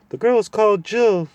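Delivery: high, loud hooting owl. Acted. Owl hooting loudly into the deaf dwarf's ear. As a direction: hoot it as loud as he can. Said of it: "loud as he could".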